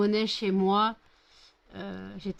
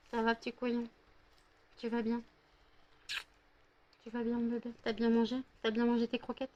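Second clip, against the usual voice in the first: soft, slightly higher cooing pet talk; 3 semitones higher, 5 dB quieter, faster than usual. Real, not acted. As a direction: coo sweetly to a little dog. Baby talk to her dog. (voix douce de bébé pour le chien)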